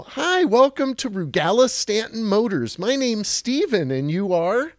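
high, bright-toned eager greeting; eager salesman greeting. Retold or imitated. Eighteen-year-old greeting his first customer, high and eager. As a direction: play the keen new salesman. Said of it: "I go".

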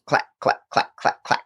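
crisp unvoiced repeated clacks; rhythmic voiceless clacking noise; imitated handcuff clicks. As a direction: mimic cuffs snapping shut one by one. Imitating handcuffs closing down line.

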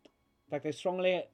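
put-on high, emphatic accented voice; grave accented doctor. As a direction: warn firmly as a foreign doctor. Game master voices accented doctor.

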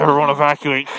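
loud evacuation call; loud announcement. Retold or imitated. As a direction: call it out over alarms. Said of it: "and you hear".

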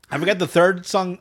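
ordinary delivery

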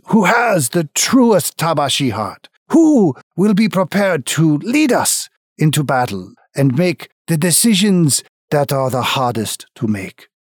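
formal ritual announcement; ceremonial announcer. Acted. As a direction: proclaim it to the whole arena. Announcer opens the ritual fight.

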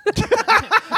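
squealing high laugh; high giggle. Real, not acted. Laughing fit, wired.

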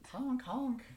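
high, loud goose-like honk; honking turtle. Acted. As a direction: squawk it like a silly turtle. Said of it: "he's a honking turtle".

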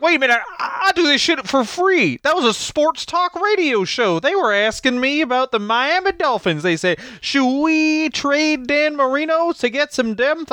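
a hammed-up Honky Tonk Man impression; imitating Honky Tonk Man. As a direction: ramble like an aggrieved old wrestler. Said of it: "He was like".

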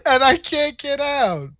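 high, slow, put-on whine; hammed-up damsel stuck and helpless; mock helpless. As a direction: wail it playfully, stretched out. Porn parody, stuck in Batmobile.

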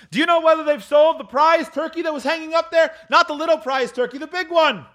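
high, excited holler; eager calling. Acted. Scrooge asking about the prize turkey, high eager call.

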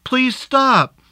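high, panicked cry of stop it; frightened scream. A narrator's reading. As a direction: scream at him to stop, terrified. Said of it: "she screamed".

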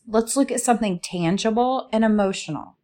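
ordinary talk, nothing marked

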